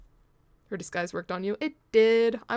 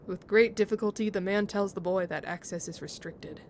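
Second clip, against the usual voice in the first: normal talking voice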